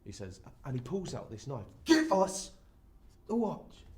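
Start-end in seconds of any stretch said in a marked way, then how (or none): 1.8-3.8 loud, high mugger's demand; sharp, raised-voice threat; forceful threat. Acted. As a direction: bark the order with a knife out. Mugger repeats demand with knife.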